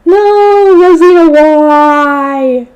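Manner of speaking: high, loud drawn-out wail; theatrical "why me" lament; despairing wail. Acted. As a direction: cry out in hammed-up despair. Narrator's despairing cry as Rosina leaves.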